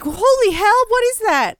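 high, loud mock alarm; imitating startled travelers. Acts out startled characters seeing snakes.

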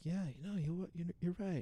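high, soft put-on voice; Sansa. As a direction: imitate a young woman meekly agreeing. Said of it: "And Sansa's like".

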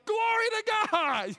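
high, loud and slow, over the top; hammed-up praise at work; gushing joy. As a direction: sing out the praise, beaming. Acting an overjoyed coworker.

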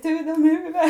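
put-on girl screaming back from the snow, laughing and crying; retold scream. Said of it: "hon bara skrek".

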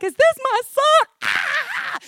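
high-pitched mock wail; over-the-top weeping father; hammed-up sobbing. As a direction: break into an exaggerated cry. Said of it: "wept loud sobs".

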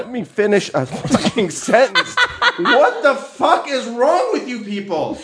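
high, laughing frustrated outburst; half laughing, half fed up; laughing exasperation. Real, not acted.

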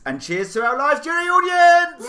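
very high, loud toast; mock grand toast. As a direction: raise a glass with a big flourish.

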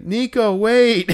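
imitating the kid's yell; calling out. Kid calling out again.

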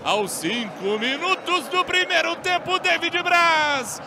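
shouted excited goal recap; explosive goal commentary. Real, not acted. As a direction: keep yelling the replay.

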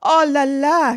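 high, loud French exclamation; crying out. A narrator's reading. Adeline sees the girl crying, exclaims in dismay. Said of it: "cried Adeline".

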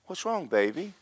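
lilting, sympathetic coo; kind and sweet. Retold or imitated. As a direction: imitate a tenderly doting older friend. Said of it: "she's really kind, real sweet".

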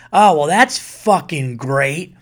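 loud, drawn-out sarcastic exasperation; exasperated outburst. Retold or imitated. Tough woman throws her phone down after reading bad news, exasperated. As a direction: stretch it out loudly, acting out her frustration. Retold woman's reaction to cancelled flight.

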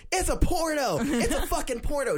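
imitating a furious man's high, loud outburst; angry yell. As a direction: yell it high and exasperated, mimicking an angry customer. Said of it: "finally he just yelled".

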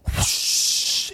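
breathy whoosh of air like a bellows. Pretend. Host imitates a bellows for listeners, a whooshing breath. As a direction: blow out a whoosh like pumping a bellows. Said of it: "say that noise again".

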